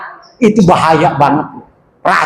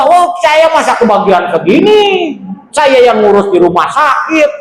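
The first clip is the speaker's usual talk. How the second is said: higher, complaining protest with a shout at the end; mock indignant daughter; 7 semitones higher than usual. Daughter complaining to her siblings about the inheritance, indignant and raised. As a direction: play the sister who feels cheated of her share.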